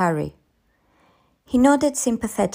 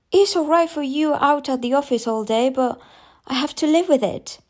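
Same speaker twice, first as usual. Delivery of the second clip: high, fed-up complaint; exasperated, pitched-up reproach; aggrieved; 8 semitones higher than usual. A narrator's reading. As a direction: protest to a husband who doesn't understand. Mother complains to her husband.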